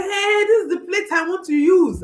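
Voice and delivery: high mock yell of a demanding child; high-pitched imitation of a screaming toddler; retold screaming. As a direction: imitate a small boy yelling his demand. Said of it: "he started screaming".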